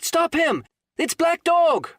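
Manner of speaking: very high, loud urgent shout; crying out. Acted. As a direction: yell to stop the fleeing man. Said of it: "I cried".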